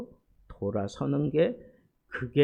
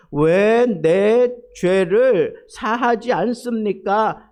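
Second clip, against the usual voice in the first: loud, slow protest, pitched higher; confronting God; 4 semitones higher, 5 dB louder, slower than usual. Acted. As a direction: demand an answer from God, drawing out each word. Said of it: "하나님 앞에 막 따질 정도로".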